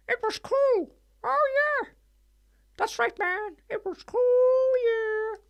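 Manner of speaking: very high squeaky cartoon character; cartoon pig voice. Recalling choir days in a put-on voice, very high cartoon pig. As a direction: put on a silly, very high toon voice. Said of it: "Who talks like that? Someone talks like that, don't they?".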